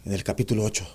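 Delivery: voz baja y monótona, sin variación; sermón plano y apagado; monótono. Shown as a demonstration. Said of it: "Es monótono también". (predicación monótona en voz baja)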